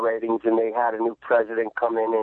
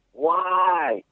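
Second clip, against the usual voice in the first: a mildly raised, anguished "why"; upset father; 6 semitones higher than usual. Retold or imitated. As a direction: retell the dad's shocked disbelief. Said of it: "he was so upset".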